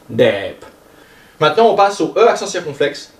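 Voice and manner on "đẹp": "đẹp" slow and low, cut off in the throat; glottal tone. Shown as a demonstration. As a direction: drop "đẹp" low and stop it short at the end. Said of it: "le ton glotté. Donc ça fait". (« đẹp » au ton glotté, bas)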